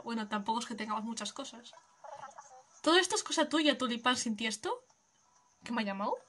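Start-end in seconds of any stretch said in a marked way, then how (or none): none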